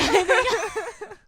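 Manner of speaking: loud high-pitched laugh. Real, not acted. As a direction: burst out laughing loudly. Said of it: "I can't stop laughing".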